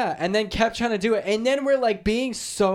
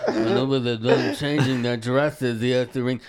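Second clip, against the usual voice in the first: mock whiny imitation of a friend, slowed down; 7 semitones lower than usual. Friend mocking Lucas's bell excuse in a silly voice. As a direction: parody him in a made-up silly voice. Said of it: "I don't talk like that".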